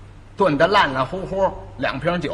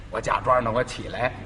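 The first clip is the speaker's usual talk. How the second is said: ordinary delivery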